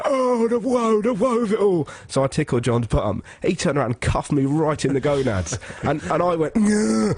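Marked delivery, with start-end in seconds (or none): 0.0-1.9 high mock-tragic lament; wailing dungeon actress. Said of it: "some woman's like". 6.6-7.1 high strangled "gah"; mock cry of pain, pitched way up; groin-kick yelp. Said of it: "when you're properly kicked in the scronks".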